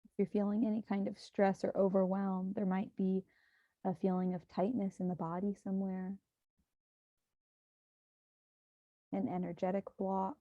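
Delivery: hushed, even meditation guidance; guided meditation. Acted. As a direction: guide listeners gently into breath and body.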